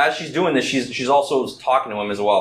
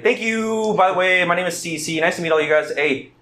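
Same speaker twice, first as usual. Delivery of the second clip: higher, eager thank-you voice; the game master as Cece thanking the party, much higher than his own voice; grateful Cece; 6 semitones higher than usual. Acted. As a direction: pitch up into a bubbly thanks.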